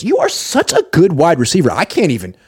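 high-pitched, fawning hype; hammed-up awestruck compliments; gushing flattery. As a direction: stroke their ego, voice way up high. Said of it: "he's, like, stroking people's egos".

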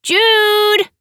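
high, loud call across the roof. A narrator's reading. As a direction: shout his name to get his attention. Nicole calling to Jude.

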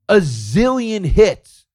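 drawn out slowly in mock amazement; mocking awe. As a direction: stretch it out like sarcastic wonder. Mocking repetition, even slower, higher.

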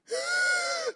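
very high pretend sob; mock crying wail. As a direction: squeal out a comic sob. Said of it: "now I'm crying".

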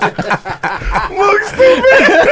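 drawn out through laughter; laughing. Real, not acted. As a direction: laugh while saying it. Laughing at friend.

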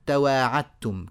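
تواعدتم slowly as "tawa'attum"; doubled t in تواعدتم, no d; الدال merged into التاء. Shown as a demonstration. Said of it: "الدال مع التاء". (إدغام الدال في التاء في "تواعدتم")

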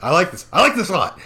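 hammed-up delighted boss; pleased boss. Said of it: "He's like".

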